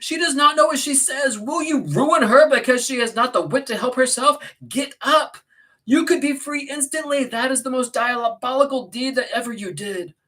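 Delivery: high, loud, indignant tirade; alarmed, impassioned high-pitched rebuke; passionate outburst. Acted. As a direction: scold him frantically to get up. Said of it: "I said passionately".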